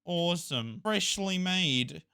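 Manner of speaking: ordinary talk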